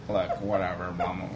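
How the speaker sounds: low, dismissive brush-off; annoying dismissive. Retold or imitated. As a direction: shrug it off lazily. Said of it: "in an annoying way".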